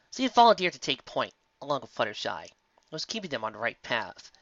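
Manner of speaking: ordinary delivery, nothing marked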